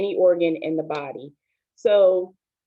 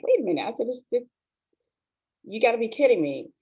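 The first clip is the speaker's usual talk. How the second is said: higher-pitched, retelling stunned disbelief; retold disbelief; 6 semitones higher, faster than usual. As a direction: relive the shock of the doctor's news, higher. Retold shock at cancer diagnosis.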